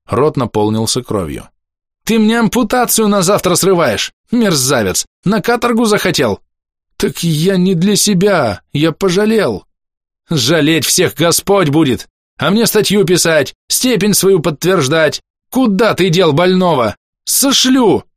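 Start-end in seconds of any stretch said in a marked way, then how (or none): 2.0-6.4 high-pitched angry tirade; гневный разнос. A narrator's reading. Doctor berates the orderly. 7.0-9.7 high, drawn-out plea; оправдывающаяся мольба. A narrator's reading. Orderly pleads with doctor. 10.3-18.0 high, heated tirade rising to a yell; гневный разнос. A narrator's reading. Doctor keeps raging at orderly.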